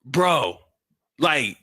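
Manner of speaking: exasperated dad. Retold or imitated. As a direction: talk her down, fed up. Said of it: "put me in a mode where I'm like".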